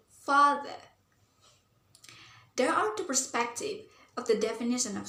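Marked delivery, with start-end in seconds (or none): 0.1-0.8 "father" with the first syllable weighted; "father", stressed at the start, lighter at the end. Shown as a demonstration. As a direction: press on the "fa" of "father". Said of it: "find the stress mark in the syllable".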